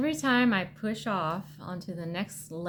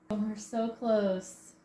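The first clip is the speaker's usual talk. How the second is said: ordinary talk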